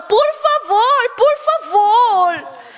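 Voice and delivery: hammed-up tearful begging; nearly crying. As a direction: beg desperately, as if close to tears. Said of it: "Depois ele tava quase chorando".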